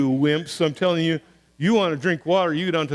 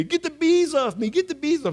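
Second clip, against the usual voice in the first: mock scream; 7 semitones higher than usual. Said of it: "screaming at me".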